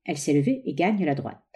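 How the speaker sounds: plain reading voice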